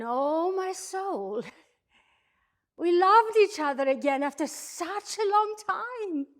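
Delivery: high, loud rapture with a laugh; exult, laughing with delight; swelling, laughing joy; overjoyed. Acted. Overjoyed wife recalls renewed love.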